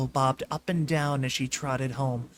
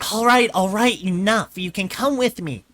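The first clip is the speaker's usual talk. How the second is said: louder, higher giving in; shouted; 5 semitones higher, 6 dB louder than usual. A narrator's reading. Twilight giving in to the whining filly, shouting. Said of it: "Twilight shouted".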